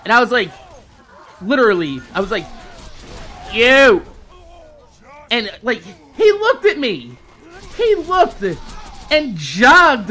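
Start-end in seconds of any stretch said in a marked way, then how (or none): 3.5-4.0 sharp loud call of you. Retold or imitated. Yelling after the FedEx driver, a loud call. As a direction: shout it after a man walking off.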